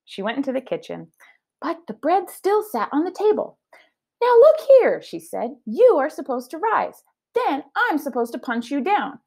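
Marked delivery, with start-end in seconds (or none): 4.2-5.0 high, bright character voice scolding the bread; Amelia Bedelia voice. Acted. Teacher reading aloud, voicing Amelia talking to bread. 5.6-9.2 higher, puzzled character voice; a hint of Amelia fussing at bread; lightly voiced Amelia Bedelia. Teacher reading aloud, Amelia scolding bread, lightly voiced.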